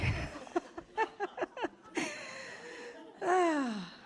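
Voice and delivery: long high burst of laughter; helpless high laugh; big real laugh. Real, not acted. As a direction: crack up at the punchline. Laughter continues.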